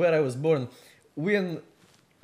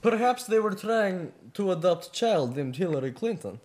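put-on Russian accent, deadpan theorizing; 4 semitones higher, 6 dB louder than usual. Vladimir explaining the adoption theory, Russian accent. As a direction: offer an absurd excuse in a Russian accent.

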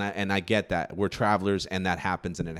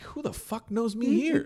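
high, slow puzzled outburst; high slowed disbelief; startled; 14 semitones higher, slower than usual. Retold or imitated. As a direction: sound caught off guard. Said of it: "I'm like".

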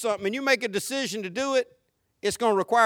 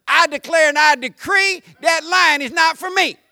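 loud, high mock-preacher decree; hammed-up shouted decree; mock declaring; 5 semitones higher, 8 dB louder than usual. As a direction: proclaim it like an overblown faith declaration. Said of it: "stands in the middle of the field and says".

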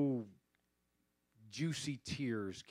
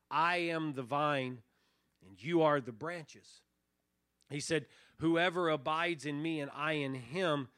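scripture reading; 4 dB louder than usual. Acted. Quotes John fifteen.

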